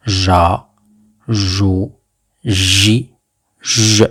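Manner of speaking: syllables spaced and carefully spoken; slow, careful drill; teacher repetition. Shown as a demonstration. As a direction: model each syllable for the class. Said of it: "je répète".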